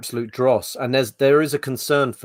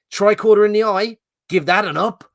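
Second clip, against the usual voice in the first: imitating the Irish reviewer; 9 semitones higher, 7 dB louder than usual. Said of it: "the Irish fella's like".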